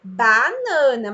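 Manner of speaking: BANANA stretched out slowly, unbroken; repeated slow dictation. Shown as a demonstration. Teacher keeps repeating for the child, BANANA drawn out. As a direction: draw out BANANA each time as one word. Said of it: "E fica repetindo".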